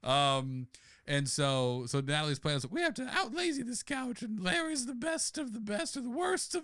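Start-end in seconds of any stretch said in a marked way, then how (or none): 2.7-6.6 high drawling Scarlett O'Hara voice; Southern belle voice. Acted. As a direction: falsetto Southern lady. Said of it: "why she's from the South".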